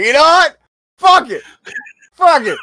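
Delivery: high-pitched, riled-up outburst; fired-up rant. Retold or imitated. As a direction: blurt it like snapping. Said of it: "now he's like".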